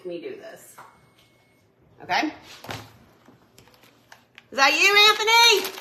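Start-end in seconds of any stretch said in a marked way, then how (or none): 4.5-5.7 calling out. Real, not acted. Calling out to someone in house.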